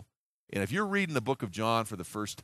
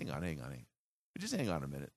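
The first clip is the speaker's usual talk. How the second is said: much lower and softer, gently halting the room; calming Jesus; 10 semitones lower, 9 dB quieter, much faster than usual. Acted. Jesus stopping the disciples' argument, low and soft. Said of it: "Jesus finally just stops everybody".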